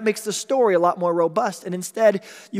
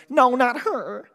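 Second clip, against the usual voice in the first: mock refusal; 8 semitones higher, 5 dB louder, much slower than usual. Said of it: "Jesus wasn't outside the tomb going".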